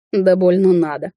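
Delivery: mild huff of disdain; snorting dismissal. A narrator's reading. Witch dismisses rival's fear, mildly scoffing. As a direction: brush it off with light scorn. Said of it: "фыркнула я".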